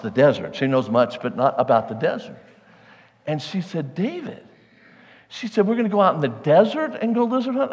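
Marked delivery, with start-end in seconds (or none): 3.9-4.4 high, disbelieving "David"; wife can't believe the plan, rising high in disbelief; incredulous wife. Retold or imitated. Wife doubting the plan, asking in high disbelief. Wife's alarmed reply, retold. 5.7-7.7 higher, fast disbelieving question; incredulous wife. Retold or imitated. Wife questioning the lizard hunt, in high fast disbelief.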